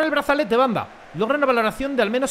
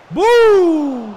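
long high goal cry; loud celebratory bu; 10 semitones higher, 11 dB louder than usual. Real, not acted. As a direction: let out a drawn victory roar. Celebrating in the game.

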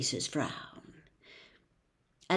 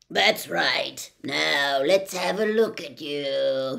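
louder, slow croaking stranger; 4 semitones lower, 5 dB louder, slower than usual. Acted. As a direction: size them up in a rasp. Cacophony inspects visitors.

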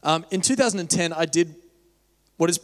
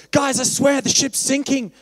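high-pitched, loud, stretched-out panicky yell; mock screaming; 9 semitones higher, 6 dB louder, slower than usual. One passenger warning others on the Titanic, mock screaming. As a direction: play someone frantically raising the alarm. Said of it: "I don't run around screaming".

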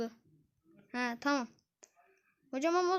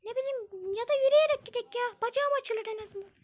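put-on girl voice; 7 semitones higher than usual. Zeynep suggests walking instead.

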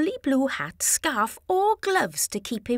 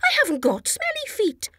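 Chickpea voice; 10 semitones higher, faster than usual. A narrator's reading. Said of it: "replied Chickpea".

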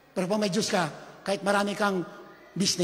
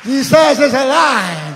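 preaching shout; 3 semitones higher, 11 dB louder than usual. Real, not acted. Preacher declares Jesus alive to congregation, triumphant shout. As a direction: belt it out to the whole church.

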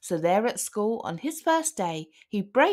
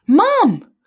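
loud, high panicked call for Mum; crying out; 11 semitones higher, 11 dB louder than usual. A narrator's reading. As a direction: yell it to Mum in alarm. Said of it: "cried the boy".

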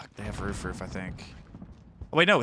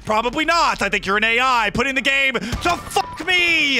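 high-pitched exasperated complaint; agitated, raised-voice accusation; incredulous rant; 6 semitones higher, 3 dB louder than usual. Real, not acted. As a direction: rant at the game, still worked up. Ranting at the game.

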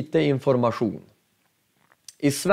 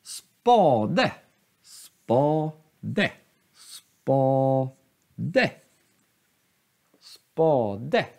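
repeated slow Spade, second syllable rising; grave accent; much slower than usual. Shown as a demonstration. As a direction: go down on Spa, up on de. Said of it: "Den går ner och sen upp".

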